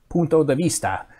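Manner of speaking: a light mock Spanish accent on Punto de Vista; funny Spanish accent. Reading a viewer's name aloud in a light mock Spanish accent. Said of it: "I have to put on a funny accent when I say that name".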